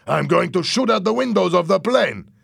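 high, loud threatening voice; shouted threat. A narrator's reading. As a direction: threaten the whole cabin. Armed threat in a quarrel.

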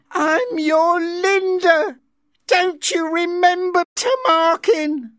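high, slow, near tears; tearful pleading. Acted. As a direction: beg him to remember you.

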